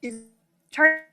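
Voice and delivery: usual speaking voice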